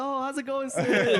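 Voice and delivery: high, loud fake-polite hello; suddenly nice because he's back; mock sweet greeting. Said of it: "and I was like".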